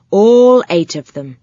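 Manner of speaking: ordinary delivery, nothing marked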